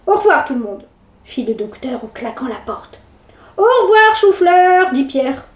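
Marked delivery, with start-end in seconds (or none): 3.6-4.9 cheeky. Acted. Cheeky goodbye.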